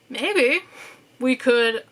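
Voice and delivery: dry, mocking maybe; sarcastic. Real, not acted. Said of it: "I say maybe in a sarcastic sense".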